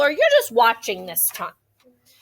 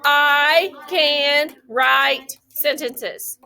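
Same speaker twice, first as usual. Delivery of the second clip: very slow, separated words; much slower than usual. Shown as a demonstration. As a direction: tap out each word as you say it. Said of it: "Tap it on your hand like she did".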